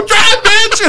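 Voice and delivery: very high-pitched mock scream; retold panicked yell. Passenger urging driver to flee after throwing Coke, retold panicked yell. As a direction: yelp the order to get away.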